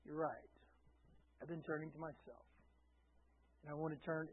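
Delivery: soft, hushed admission of fault; quiet confession. Retold or imitated. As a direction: murmur it like a humble, sincere confession. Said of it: "So the moment you say".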